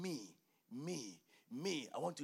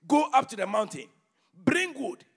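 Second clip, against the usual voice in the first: preaching at full force; 4 semitones higher, 12 dB louder than usual. Acted. Preacher reading Haggai aloud to his church, booming. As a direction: thunder the prophet's words over the congregation.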